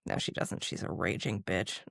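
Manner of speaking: low, soft stage whisper of a mock insult; hushed joking aside. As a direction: whisper the jab as a fake secret. Joking aside about friend.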